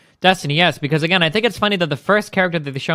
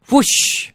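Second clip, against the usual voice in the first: voiceless rush of air; airy comic sound effect; imitated whoosh; 9 semitones higher, 3 dB louder than usual. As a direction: make a mouth-made whoosh effect.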